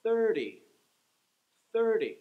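Thirty punched high and loud on the first syllable; stress on THIR. Shown as a demonstration. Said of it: "the first syllable that gets the stress".

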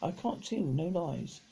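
ordinary delivery

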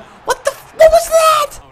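very high, squeaky disbelief; shocked outburst. Real, not acted. Gamer reacting to a bad play, real high-pitched shock. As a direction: blurt it out sky-high in shock.

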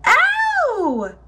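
very high, drawn-out yelp; pained cry. A narrator's reading. Aluki sipping from the hot bowl, crying out. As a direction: cry out as if scalded. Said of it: "she cried out".

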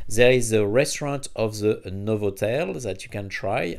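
ordinary delivery

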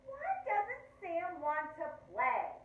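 slow, high, loud question; frustrated wondering. A narrator's reading. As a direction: drag out the baffled question. Lulu's frustrated thought.

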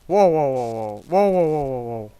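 гучні злякані воу-воу; крикни з переляку на монстра; переляканий вигук. Real, not acted. (гучне перелякане «воу-воу»)

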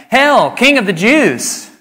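loud, high, slow mock salute; mocking hail. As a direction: sneer it like jeering soldiers. Soldiers mock Jesus.

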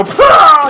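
loud, high burst of a yell; imitated explosion cry. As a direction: mimic the blown-up guy's cry. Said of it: "you hear the guy go".

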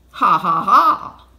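loud, spelled-out ha-ha-ha, rising; triumphant mock laugh. A narrator's reading. Mister Dart crowing over the thieves, loud laugh. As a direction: laugh loudly at your own joke.

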